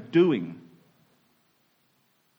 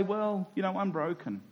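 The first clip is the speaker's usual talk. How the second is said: resigned shrug; 9 dB quieter than usual. Retold or imitated. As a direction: mumble it like a shrugged-off excuse. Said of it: "easy for us to just say".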